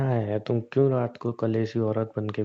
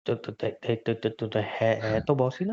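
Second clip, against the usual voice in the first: nervous stutter on "तो"; tongue-tied stammer. Real, not acted. Husband cornered by his wife about his boss, tongue-tied stammer. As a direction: trip over "तो" several times before getting the answer out. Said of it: "ये तुम्हारी जुबान क्यों ऐसे हो रही है अजीब".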